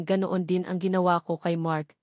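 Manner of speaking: usual speaking voice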